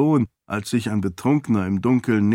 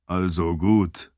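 low, slow giving in; reluctant concession; 4 semitones lower, slower than usual. A narrator's reading. Seon finally yields to his lady, reluctant. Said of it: "räumte er schließlich ein".

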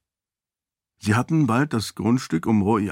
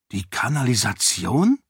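incredulous echo question, rising at the end; Sarene, incredulous. A narrator's reading. Lady hears the king went into the sewers, incredulous. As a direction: query it in disbelief. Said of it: "fragte Sarene ungläubig".

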